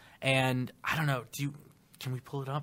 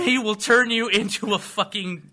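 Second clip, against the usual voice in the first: high, loud exasperated comic; hammed-up exasperation, rising; Dave Chappelle impression; 5 semitones higher, 5 dB louder than usual. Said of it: "He was like".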